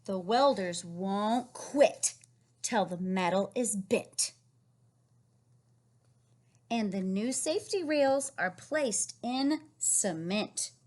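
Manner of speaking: slow storybook reading; reading to children. Acted. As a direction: read the page slowly to a child. Reading rhyming book text.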